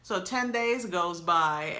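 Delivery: ordinary delivery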